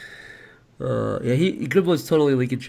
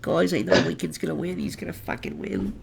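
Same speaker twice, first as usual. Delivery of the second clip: imitating Grumble; 3 semitones higher, 4 dB quieter than usual. Host echoes the character's line.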